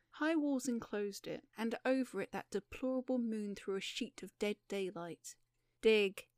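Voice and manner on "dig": loud, abrupt command; barked order. A narrator's reading. Woman with revolvers to her prisoners, loud command. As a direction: order them to it, much louder. Armed captor orders men to dig.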